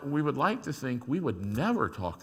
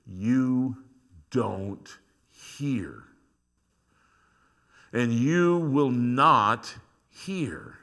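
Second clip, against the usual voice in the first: accusing; 5 dB louder, much slower than usual. Acted. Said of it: "then comes the accusation".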